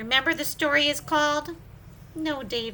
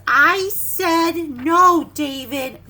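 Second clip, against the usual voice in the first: loud, slow I said no; slow loud reprimand; stern parent; 9 dB louder, slower than usual. Acted. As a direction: repeat it with final firmness.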